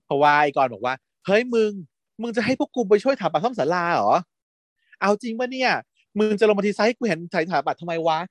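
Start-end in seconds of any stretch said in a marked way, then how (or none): 1.1-8.2 higher-pitched retold disbelief; incredulous protest. Engineering friend challenging the plan, incredulous protest. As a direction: question him as a put-out friend would.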